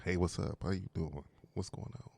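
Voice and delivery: low, soft seductive murmur; sexy voice. Shown as a demonstration. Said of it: "I can get into that sexy voice".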